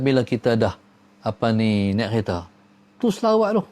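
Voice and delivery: ordinary delivery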